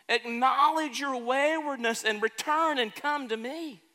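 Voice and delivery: slow, swelling preacher's voice; preaching. Acted. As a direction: lean into it like a pulpit sermon. Preacher voices God's call.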